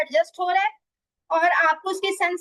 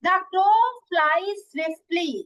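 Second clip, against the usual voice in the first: very slow example sentence, word by word; slow dictation; much slower than usual. Shown as a demonstration. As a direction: dictate it so students can write. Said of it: "teacher dictates example sentence". (धीमी, शब्द-दर-शब्द लिखवाने वाली आवाज़)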